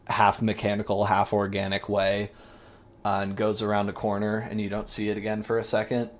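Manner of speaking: ordinary talk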